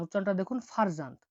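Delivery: normal talking voice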